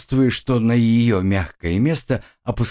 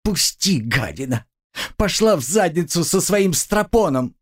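struggling against restraints; 8 semitones higher than usual. A narrator's reading. Girl struggles and curses.

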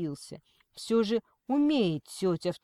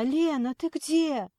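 high, raised anxious call; 8 semitones higher, 4 dB louder, slower than usual. A narrator's reading. Aunt gets no sight of Lena, calling out. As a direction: cry out for someone who is missing. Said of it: "крикнула она".

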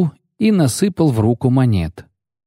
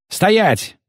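higher, commanding cry; shout; 11 semitones higher than usual. A narrator's reading. Rider bursts in to halt the execution, shouting. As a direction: stop the execution at once. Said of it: "крикнул наездник".

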